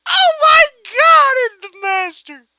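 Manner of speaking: very high, loud fan scream; screaming fan joy; ecstatic scream. Retold or imitated. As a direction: squeal with delight. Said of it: "I'm just like".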